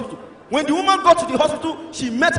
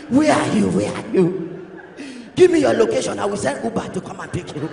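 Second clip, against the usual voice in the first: hoarse, lower, straining to be heard; voice locked up; 4 semitones lower than usual. Acted. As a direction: plead through a lost voice. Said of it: "voice don't lock".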